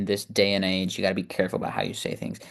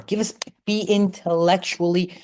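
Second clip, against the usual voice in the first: indignant Karen; 7 semitones higher than usual. Real, not acted. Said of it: "I'm looking like a Karen right about now".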